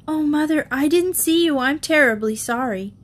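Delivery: lightly voiced apologetic child; sorry little girl. A narrator's reading. Sharon apologizing to her mother, lightly childlike. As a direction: plead sorry as a young girl. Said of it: "instantly sorry".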